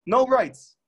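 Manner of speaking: "No rights" hit high and loud, "No" stressed; emphatic declaration. Acted. Preacher to listeners, "No rights" declared high and loud. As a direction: land "No" like a gavel. Preacher punches the key point.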